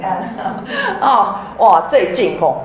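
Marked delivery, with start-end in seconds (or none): 0.3-1.0 short falling chuckle; a quiet amused chuckle; laugh. Real, not acted. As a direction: laugh softly before starting. Opening laugh to audience.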